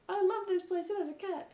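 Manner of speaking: high-pitched imitation of a smitten friend; delighted gushing. Teasing her friend about the hotel cat, high gushing imitation.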